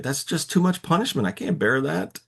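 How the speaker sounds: high, whiny mock complaint; complaining. Cain complaining to the Lord about his punishment, high whine. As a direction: whine that it's too much to bear. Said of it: "he's complaining".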